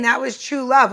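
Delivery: ordinary talk, nothing marked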